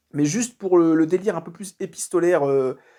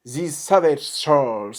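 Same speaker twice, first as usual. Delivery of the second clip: slow, careful English accent on "These Savage Souls"; a French speaker mocking his own English accent on "These Savage Souls"; drawn-out English accent on the title, a little self-conscious; clumsy English accent; much slower than usual. Shown as a demonstration. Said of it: "excusez mon accent tout pourri anglais".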